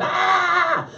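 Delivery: high-pitched acted-out scream; mock scream. Husband imagines wife waking up and seeing him, mock scream. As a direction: let out a mock startled scream. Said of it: "start screaming".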